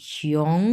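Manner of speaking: শিয়ং starting low and rising; second tone. Shown as a demonstration. Teacher modelling a Chinese word, rising শিয়ং. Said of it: "দুই number tone".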